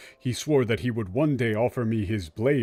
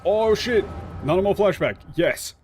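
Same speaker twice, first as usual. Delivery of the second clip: loud, higher, thrilled yelp; excited outburst; 7 semitones higher, 4 dB louder than usual. Real, not acted. Streamer thrilled as a flashback starts, excited shout. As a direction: burst out with real delight.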